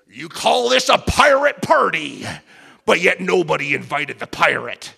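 loud mock pirate captain shout; hammed-up Hook taunting the crowd. Pastor voicing Captain Hook taking over the deck party. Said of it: "And he says".

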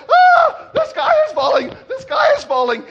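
very high scream into loud shouting; storybook birds screaming in terror; mock panic. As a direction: shriek the alarm while fleeing, hammed-up. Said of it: "panic strikes Cocky Locky".

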